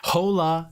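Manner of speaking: 'Hola' with an audible breathy H at the front; H sounded. Shown as a demonstration. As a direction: say 'Hola', voicing the H instead of dropping it. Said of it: "when they make the H".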